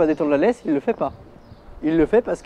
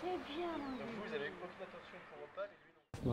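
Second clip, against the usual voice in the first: hushed, much softer aside; whispering; 3 semitones higher, 18 dB quieter than usual. Real, not acted. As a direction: whisper so the dog stays calm. Speaking softly beside the dog.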